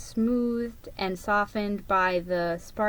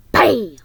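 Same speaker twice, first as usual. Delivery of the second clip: mock explosion; 10 dB louder than usual. Ricky imitates explosion.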